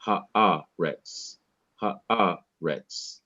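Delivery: haaretz with a held stressed A, slight pause, then RETZ; pause before last syllable. Shown as a demonstration. Teacher demonstrating the accent mark, haaretz held and paused before RETZ. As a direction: linger on the A of haaretz, break briefly before RETZ. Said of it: "I should slow down and pause a little bit".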